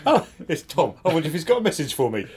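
bright, quick excited chatter. Pretend. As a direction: pounce on the phone message. Acting excited at a phone alert.

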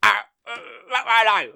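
mock him as a moaning loser; whiny impression. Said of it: "impression show".

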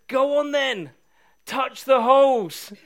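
Jesus urging Thomas, lightly voiced and loud; Jesus daring him. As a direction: challenge him kindly, drawing it out. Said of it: "And Jesus says".

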